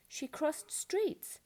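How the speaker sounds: ordinary delivery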